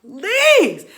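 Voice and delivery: high, loud drawn-out plea; desperate begging. Acted. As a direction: plead for mercy in a hammed-up wail. Acted begging not to be punished.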